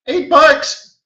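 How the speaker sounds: high, shocked disbelief; amazed outcry. Real, not acted. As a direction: cry out the price in astonishment. Disbelief at the cheap price.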